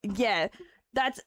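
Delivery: ordinary talk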